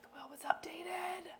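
hushed reveal, breathy and secret; dramatic whisper. Retold or imitated. Podcast host mimics a dramatic reveal in a hushed whisper. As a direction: whisper it like a TV cliffhanger. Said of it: "You're like".

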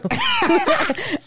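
high nervous laugh; embarrassed laugh. Real, not acted. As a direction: laugh it off flustered.

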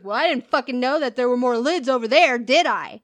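mock the huffy customer; angry rant. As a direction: customer snaps back at the cashier.

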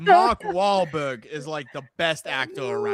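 put-on slow-starting Boston accent, R's dropped; secular Boston accent. Asked for secular Boston, mock accent with dropped R's. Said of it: "sounds like you can't pronounce R's".

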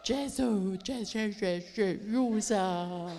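loud, hammed-up Franco-Italian accent; mock foreign accent. Traveller evangelising the young woman, loud mock foreign accent. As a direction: bellow broken foreign English with dragged endings. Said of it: "I said".

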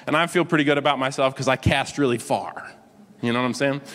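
normal talking voice

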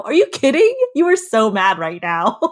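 loud, high-pitched mock outburst; mock disbelief. Arguing with herself about being mad, loudly incredulous. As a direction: call out your own denial, incredulous. Mocks own denial of anger.

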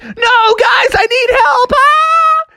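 very high cartoon cry for help; panicked shriek. A narrator's reading. Froggy swarmed by biting mosquitoes, high panicked wail. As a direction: squeal up into a mock scream.

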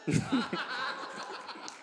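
high light chuckle; warm laugh. Real, not acted. Man laughing about his tenth year, high chuckle. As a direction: let the laugh out.

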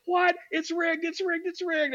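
high-pitched frantic outcry, imitating outraged fans; mock freaking out. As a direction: squeal it in exaggerated disbelief, like a panicking crowd. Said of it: "freaking out".

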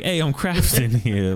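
hint of New York wiseguy; lightly voiced Brooklyn tough guy; New York accent. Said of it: "Scraeus was like".

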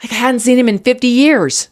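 breathless shock of seeing him again; wife at the door, gasping loudly in delight; gasping surprise. Retold or imitated. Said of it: "this is what I went".

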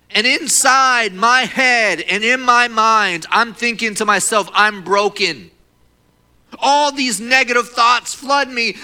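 loud, high, drawn-out preaching; impassioned preaching. Real, not acted. Preacher tells church his inner thoughts, anguished and loud.

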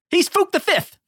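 very high squirrely proclamation. Acted. Player riffs on naming the character's father, squirrely voice. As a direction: pitch it way up, small squirrely boaster.

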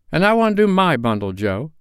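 put-on high, loud defiant old woman; cheeky old woman daring the others; bold charwoman. A narrator's reading. Charwoman voiced.

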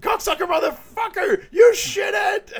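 high, loud mock scream; comic shrieked outburst; imitated pain cursing. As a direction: swear as if wax tore your hair out. Acting out waxing curses.

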